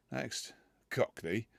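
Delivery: ordinary delivery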